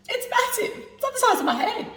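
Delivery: high, laughing wonder; amazed. Real, not acted. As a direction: marvel at the size.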